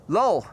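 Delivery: Lol said the Dutch way, as lul; reading a registration as a rude Dutch word; Dutch pronunciation. Shown as a demonstration. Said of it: "In Dutch, pronounced as lul".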